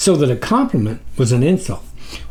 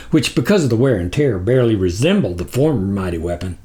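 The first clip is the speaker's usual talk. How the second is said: ordinary delivery, nothing marked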